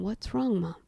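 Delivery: soft, worried question to a parent; gentle concern. Retold or imitated. Child asking still-crying mother, gentle concern. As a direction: ask it quietly, carefully.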